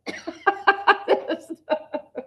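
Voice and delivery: bright high burst of laughter. Real, not acted. Teacher laughs at own joke.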